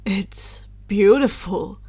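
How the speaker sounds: slow, awed breath of a line; breathed in awe. A narrator's reading. Harry gazing at the diadem, slow and awed. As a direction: let the words out in wonder. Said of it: "he breathed out in awe".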